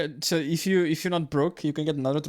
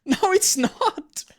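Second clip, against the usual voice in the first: very high, drawn-out denial; squealed contradiction; 12 semitones higher, much slower than usual. Real, not acted. Friend disputing the recalibration method, squealed contradiction.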